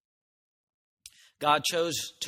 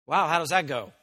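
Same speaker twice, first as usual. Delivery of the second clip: normal talking voice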